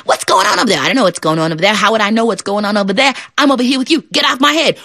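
high, fast frog bickering; high squabbling frogs. Acted.

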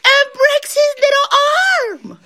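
high wail, climbing to the last word; drawn-out, hammed-up distress; building to crying. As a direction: work yourself up into a mock wail. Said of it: "She starts crying".

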